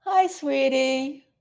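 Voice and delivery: slow, soft hi, sweetie; tender greeting. Real, not acted. Welcoming a viewer in the chat, slow and tender. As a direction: greet them fondly, taking your time.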